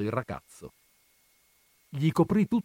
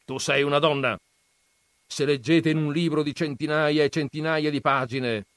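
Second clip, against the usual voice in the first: lightly voiced scornful old grandfather, raised; gruff grandfather; 6 semitones higher, 6 dB louder, faster than usual. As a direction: scoff at the books' dreamers. Grandfather retorts. (nonno burbero accennato, tono sprezzante)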